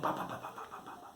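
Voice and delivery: whispered fading heartbeat sounds; soft heartbeat imitation. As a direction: tap out the heartbeat in a breath. (latidos susurrados que se apagan)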